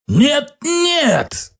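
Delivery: high, hoarse croak. Acted. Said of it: "прохрипел француз".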